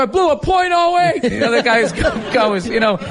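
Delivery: mocking the guy who blew point oh eight; mock whiny complainer. Mocking a complaining drunk driver.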